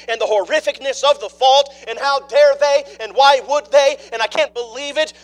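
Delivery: loud, high, driving sermon delivery; a preacher building to full force; full-force preaching. Acted. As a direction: preach it out to the back pews.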